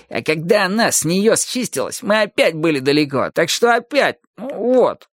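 high, muddled excuse in a put-on voice; mumbling footballer henchman. A narrator's reading. Second henchman backs up his mate's excuse, muddled and high.

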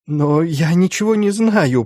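high, frightened bleat. A narrator's reading. Terrified Schulz protests to the inquisitors, high bleating denial. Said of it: "проблеял он".

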